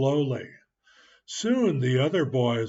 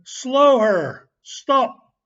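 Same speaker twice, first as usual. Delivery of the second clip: much higher, much louder captain's call; shouted order; 10 semitones higher, 9 dB louder, much slower than usual. Acted. Ben playing riverboat captain shouts to his crew. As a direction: bellow it like a boat captain. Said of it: "he shouted".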